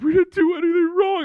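high-pitched tearful girlfriend imitation; mock crying. Girlfriend protesting innocence after being caught, mock crying. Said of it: "My girlfriend starts crying".

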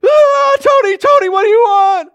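high, loud panicked screaming; terrified. Acted. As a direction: shriek the questions in fright. Terrified man facing brother's ghost.

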